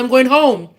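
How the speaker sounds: mock huffy walkout. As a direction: raise the voice to announce you're leaving. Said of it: "I said".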